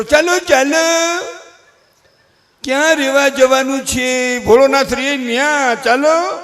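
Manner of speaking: high, stretched sing-out call; mock summons, slow and high; drawn-out call. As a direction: call them along on long, held high notes. Acting out a drawn-out call to others.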